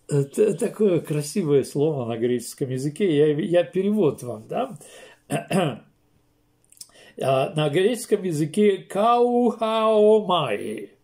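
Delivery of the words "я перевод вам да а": ordinary delivery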